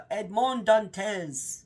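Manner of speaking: put-on Edmond Dantes drawn out slowly in a French accent, nasal ahn vowels; imitating the narrator's slow, dramatic French Edmond Dantes. Imitating the audiobook narrator's French accent, slow and dramatic. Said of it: "it's just very dramatic".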